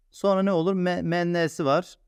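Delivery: ordinary delivery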